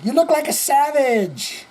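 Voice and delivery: mock a prim parent scolding bad manners; imitating stern parents. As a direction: parents scolding a child about the pinky. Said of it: "parents that said".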